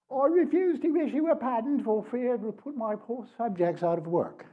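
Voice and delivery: high-pitched mock British queen's voice; female British accent. Speaker gives audience a quote to guess, in female British accent. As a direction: put on a British woman's accent, pitched well up. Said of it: "my best female British accent".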